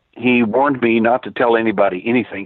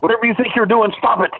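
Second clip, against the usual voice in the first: retold furious rant, much higher pitch; 11 semitones higher than usual. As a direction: imitate the angry webmaster yelling. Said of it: "He was furious".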